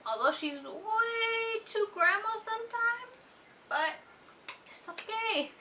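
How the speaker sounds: high, slow tearful voice that cracks; fighting back real tears, slowly; teary-voiced daughter thanking her mom; crying. Real, not acted.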